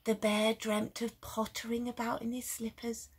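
plain reading voice

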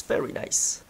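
very nice whispered softly. Real, not acted. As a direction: breathe very nice unvoiced.

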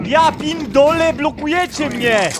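loud, slow, high angry cry; hit hard crying out. Real, not acted. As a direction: yell it out in frustration, drawn out. Teammates blocking him. (głośny, przeciągły okrzyk złości)

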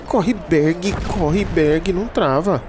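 high, urgent yell to run; grenade warning yell. Real, not acted. Grenade lands, calls teammate.

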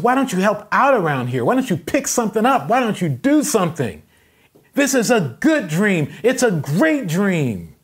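loud, high, impassioned yell; yelling dad. Retold or imitated. Dad defending his dream to his son, yelling. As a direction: holler each demand like a fed-up father. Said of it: "the dad's yelling".

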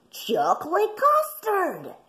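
high, excited puppy cry; yappy panting puppy. A narrator's reading. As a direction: squeal it like an eager puppy. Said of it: "they cried".

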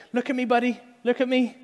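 put-on high, gentle call to a hurt kid; parent comforting a child. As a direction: coax a fallen child to look up. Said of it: "first thing I like to do is be like".

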